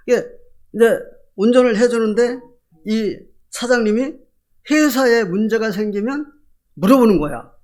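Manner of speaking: ordinary delivery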